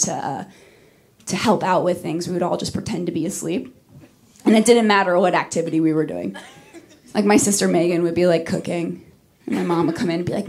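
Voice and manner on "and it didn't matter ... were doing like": ordinary talk, nothing marked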